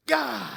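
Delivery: loud, high strained grunt of effort; straining gym grunt. Retold or imitated. Pastor imitating a gym guy flexing in the mirror, loud strained grunt. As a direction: bark out a mock weightlifter's grunt. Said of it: "and he goes like this".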